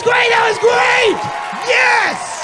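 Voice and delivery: high, loud celebratory shout; excited cheering. Real, not acted. To Elise after her guess, a high excited cheer.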